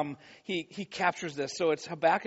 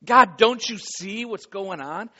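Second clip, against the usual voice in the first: raised, pleading cry to God; 3 semitones higher, 8 dB louder than usual. Acted. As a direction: build to a loud, drawn-out appeal. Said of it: "And we cry out for him".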